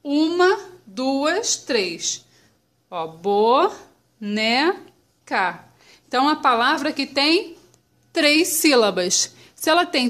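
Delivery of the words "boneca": "boneca" slowly, as bo, ne, ca; syllable by syllable. Shown as a demonstration. Teacher recapping the split, slow separate syllables. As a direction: gap after each syllable of "boneca". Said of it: "Vamos separar a palavrinha boneca".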